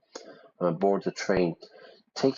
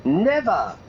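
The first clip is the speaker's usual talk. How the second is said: mock outburst; 10 semitones higher, 5 dB louder than usual. Echoing game character's outburst.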